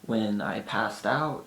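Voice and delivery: ordinary talk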